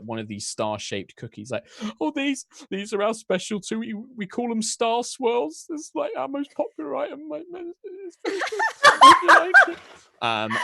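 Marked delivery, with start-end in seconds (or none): 2.0-9.8 very high Cockney, breaking into laughter; Cockney baker woman. Acted. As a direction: proudly offer the cookies in Cockney. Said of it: "English accents".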